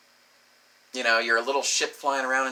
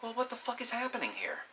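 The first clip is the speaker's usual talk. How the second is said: high, softer mock exasperation; high-pitched bafflement; 10 semitones higher, 9 dB quieter than usual. Baffled man confronting his partner, high-pitched exasperation. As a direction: pitch it up in helpless confusion.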